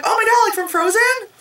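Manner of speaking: very high-pitched gushing question; mock excited girl. Storyteller mimics movie girl hearing song comparison, mock excited girl. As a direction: imitate a girl squealing with recognition.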